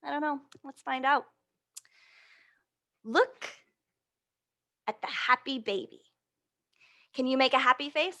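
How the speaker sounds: ordinary talk, nothing marked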